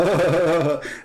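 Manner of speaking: a short acted laugh; mock laugh; imitated laugh. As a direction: laugh it out like the old man. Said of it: "he laughed".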